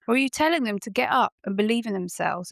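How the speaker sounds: ordinary talk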